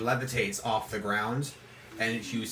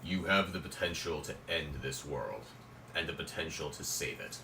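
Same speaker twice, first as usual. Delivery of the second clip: lightly voiced grave spirit, lower and softer; deep booming voice; 7 semitones lower, 5 dB quieter than usual. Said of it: "He says".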